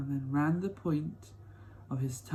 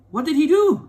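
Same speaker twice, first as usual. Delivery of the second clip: put-on much higher, louder eager child's question; eager boy; 13 semitones higher, 12 dB louder than usual. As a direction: ask like a thrilled little boy. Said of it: "asked Charlie eagerly".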